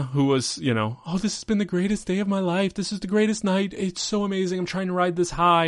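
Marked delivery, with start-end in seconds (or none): 1.1-5.7 high, breathless giddy gush; mock giddy gushing high voice; gushing Lana. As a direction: play her as dizzy with joy. Lana gushing over her win.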